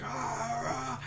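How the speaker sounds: high, hushed imitation of yelling; mock distant yell. Friend yelling from the bathroom, mock strained yell. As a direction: imitate a far-off yell, kept soft. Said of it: "Jake yelling at us from the bathroom".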